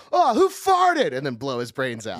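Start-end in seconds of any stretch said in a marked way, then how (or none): 0.1-1.1 loud mock outburst. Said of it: "then he would go".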